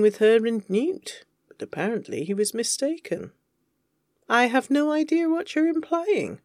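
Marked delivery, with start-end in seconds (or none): 4.3-6.4 high, drawn-up haughty denial; feigned innocence. Acted. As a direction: play innocent in a higher, affronted voice. Feigning innocence when teased about a crush.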